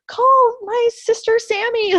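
high, loud, slow urgent call; excited demand. Retold or imitated. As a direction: insist with giddy urgency. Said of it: "And I'm like".

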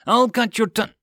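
high, loud hissed menace; furious threat. A narrator's reading. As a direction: spit the threat out. Aide threatens with sword.

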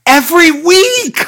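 high, loud, slow exasperation; drawn-out exasperated yell. Real, not acted. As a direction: shout it out, fed up with the repetition. Exasperated rant about repetition.

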